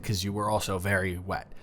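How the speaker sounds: ordinary talk, nothing marked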